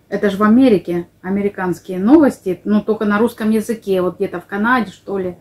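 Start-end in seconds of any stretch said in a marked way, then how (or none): none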